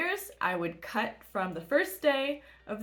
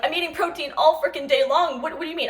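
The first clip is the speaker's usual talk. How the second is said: higher, louder flustered protest; retold spiraling; 6 semitones higher, 6 dB louder, faster than usual. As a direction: replay an indignant spiral, pitched up. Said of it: "I'm spiraling".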